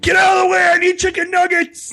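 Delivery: high, loud desperate yell; frantic shout. Retold or imitated. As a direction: yell it like a starving woman shoving through. Mocking the rushing manager.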